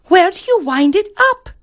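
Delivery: put-on very high, girlish question; squeaky princess. Princess asks about the music box, high voice. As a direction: ask it in a tiny princess voice.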